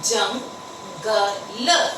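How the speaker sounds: Jungle drawn out very slowly; slow sounding out. Shown as a demonstration. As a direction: stretch jungle long. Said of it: "ज के ऊपर आवाज आ रही है".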